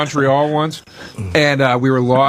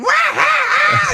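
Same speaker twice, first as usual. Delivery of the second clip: very high, drawn-out mock cackle; imitated hard laughter; much slower than usual. Comic mimicking Patrice cracking up at the car window, wild laugh. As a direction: imitate someone helpless with laughter. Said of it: "We're laughing so hard. And he's going".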